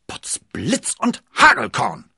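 loud, high, drawn-out oath; slow booming outcry, pitched high; exclaiming. A narrator's reading. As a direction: cry out a hearty curse in astonishment. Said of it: "rief D'Artagnan".

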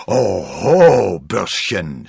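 loud, menacing swoop on the first word; shouting. A narrator's reading. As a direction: yell a warning at the upstart. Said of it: "schrie er". (drohend geschrien)

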